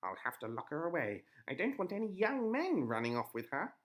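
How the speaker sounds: high, loud witch; jealous old witch. A narrator's reading. Witch voiced.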